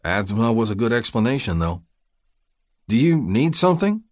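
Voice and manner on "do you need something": lifted, worried question; concerned. A narrator's reading. Man offers help as woman struggles to breathe, concerned. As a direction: ask with urgent concern. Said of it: "I asked in concern".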